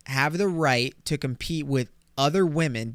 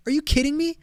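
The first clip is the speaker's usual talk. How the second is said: pitched way up, incredulous; exasperated disbelief; 14 semitones higher, faster than usual. Real, not acted. Learning the death post was a hoax, incredulous.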